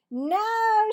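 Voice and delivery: high, loud offended no; indignant girl. Acted. Cassie to Marvin, high and indignant. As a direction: scoff at the very idea.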